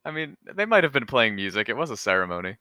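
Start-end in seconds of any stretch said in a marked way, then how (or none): none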